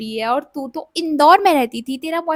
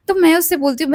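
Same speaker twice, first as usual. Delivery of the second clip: ordinary talk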